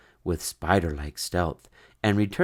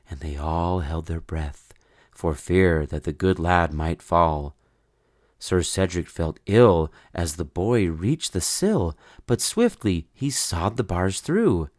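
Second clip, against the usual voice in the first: ordinary delivery, nothing marked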